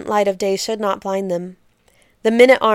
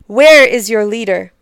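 loud, proud demand; proudly; 7 dB louder than usual. Acted. Queen demanding the town's leader, loud and proud. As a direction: ask as one who expects obedience. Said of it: "asked Anayat proudly".

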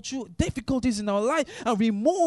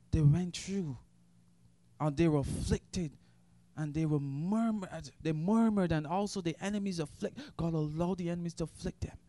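low, much softer preaching, drawn inward; hushed sermon; 5 semitones lower, 7 dB quieter than usual. Acted. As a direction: speak it softly to the church, like a confidence. Quiet reflective sermon stretch.

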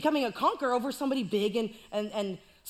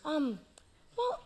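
put-on sheepish young David; 5 semitones higher, 5 dB quieter than usual. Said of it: "He's like".

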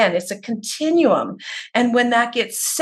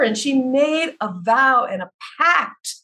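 usual speaking voice

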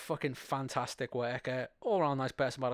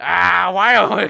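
loud, higher-pitched hammy boss impression; Vince McMahon; 6 semitones higher, 18 dB louder, slower than usual. Podcaster attempts a wrestling boss accent for laughs, loud botched impression. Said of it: "in a Vince McMahon accent".

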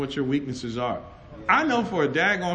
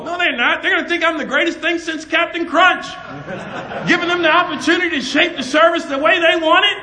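loud, high preacher shouting with laughs; preaching at full force; 10 semitones higher, 7 dB louder than usual. Acted. Preacher rallies his congregation in a loud, high shout. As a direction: fire it at the crowd like a revival.

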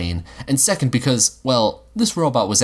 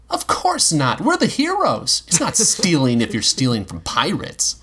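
put-on higher, protesting kid's voice; boy correcting his robot friend, amused; indignant boy; 7 semitones higher than usual. A narrator's reading. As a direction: insist you're the hero. Will insists they're heroes.

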